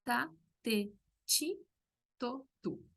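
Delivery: ta, te, chi, to, tu with ti said chi; t syllable drill. Shown as a demonstration. Teacher drilling Brazilian t syllables, chi for ti. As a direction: run the syllables, chi in the middle.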